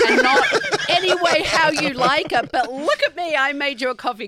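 laughing mockery. Mocking him, laughing.

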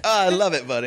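high-pitched, hammed-up gushing; playful put-on delight; mock party schmoozing. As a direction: play along as a chummy guest. Co-host joins the party bit.

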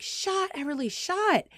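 mock sideline call to shoot; urgent cheering. Coach to timid girl as she dribbles, urgent cheering. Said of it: "cheering her on and encouraging her".

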